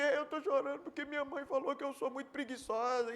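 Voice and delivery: put-on tearful whine; mock crying. Preacher playing someone crying over mother's remark, mock sobbing. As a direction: pretend to sob out a childish complaint. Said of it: "Fulano tá chorando por quê?".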